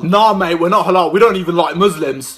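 ordinary talk, nothing marked

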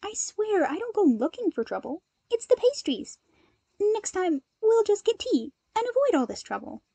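lightly voiced Izuku, breezy and joking. A narrator's reading.

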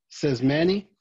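usual speaking voice

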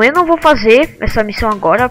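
ordinary talk, nothing marked

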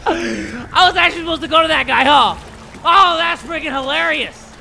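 loud, high, hammed-up amusement; mock hilarity. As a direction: crow it loudly over your own blunder. Mock hilarity at own mistake.

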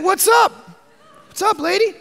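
hammed-up high, loud what's up lady; mock casual greeting. Jesus jokingly greeting the woman, hammed-up casual. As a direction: play Jesus as a breezy dude.

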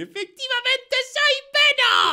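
high, loud, slow proclamation; triumphant reveal. Acted. Racer reveals secret to friends, triumphant shout. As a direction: draw out the big boast. Dramatic reveal of being Venom.

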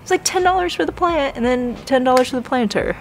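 high, fast rush over the bargain; excited. Real, not acted. Shopper excited about a cheap price, high and fast. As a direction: rattle off the deal in delight.

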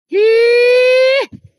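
very high, loud burst of laughter; shrieking laugh. Real, not acted. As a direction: cackle out of nervousness. Laughing after calling spirits.

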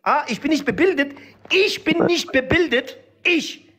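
high, slow, broken-German indignation; offended repeat. Acted. Character offended at being called uneducated, high accented disbelief. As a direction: repeat the insult back in disbelief.

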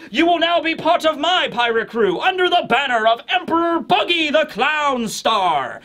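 a grand clown captain welcoming a recruit; boastful pirate captain. Retold or imitated. Buggy welcoming the old man, high theatrical showman. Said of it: "So he's just like".

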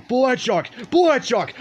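calling out. Real, not acted. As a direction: call his name out loud. Calling out for a friend.

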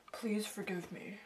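begging in a whisper; whispered plea. Acted. Begging forgiveness.

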